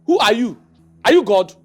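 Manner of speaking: high, loud defiant ranting; angry rant. Acted. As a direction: shout him down. Ranting at his friend and queen.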